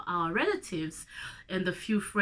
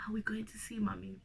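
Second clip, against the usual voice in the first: whispered; 10 dB quieter, faster than usual. Retold or imitated. Little brother's hopeful question.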